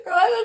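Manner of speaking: real laugh, high and loud; high burst of laughter. Real, not acted. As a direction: break into a high cackle of laughter. Cracks up at Grail comparison.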